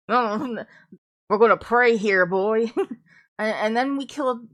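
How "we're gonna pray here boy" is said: louder mock Appalachian, lording it over a boy; Appalachian accent. Reviewer mocking the book's praying folk, louder Appalachian accent. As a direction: put on a hammed-up backwoods Appalachian accent and push it out. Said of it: "I put on my Appalachian for you".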